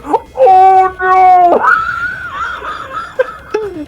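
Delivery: loud panicked shout. Retold or imitated. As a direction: yell it in panic. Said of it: "It's the opposite of".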